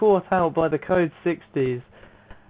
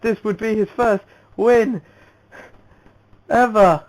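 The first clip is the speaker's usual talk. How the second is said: loud, raised race call at the finish line; excited race commentary; 6 semitones higher, 7 dB louder than usual. Real, not acted.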